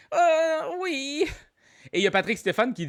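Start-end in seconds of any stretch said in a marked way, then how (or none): 0.1-1.3 high drawn-out yes. Real, not acted. Host reacts to a viewer's comment.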